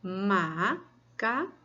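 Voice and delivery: "Macaco" slowly broken in three; syllable by syllable. Shown as a demonstration. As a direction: leave a gap after each syllable of "Macaco". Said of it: "conte uma sílaba".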